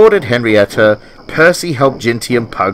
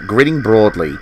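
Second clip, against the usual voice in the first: ordinary delivery, nothing marked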